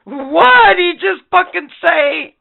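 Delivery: high, loud, slow mock outrage. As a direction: play the appalled listener.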